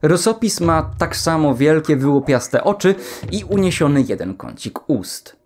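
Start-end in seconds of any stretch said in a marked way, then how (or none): none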